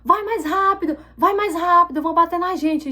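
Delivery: high-pitched mock yell of a panicking passenger; imitated yelling. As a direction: imitate someone yelling at the driver. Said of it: "começa a gritar no meu ouvido".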